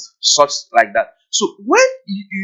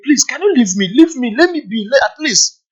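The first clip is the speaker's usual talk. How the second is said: higher, pleading voice; pleading to be left; 8 semitones higher than usual. Retold or imitated. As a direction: beg them to let you be. Partner begging to be left alone.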